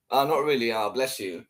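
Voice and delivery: ordinary delivery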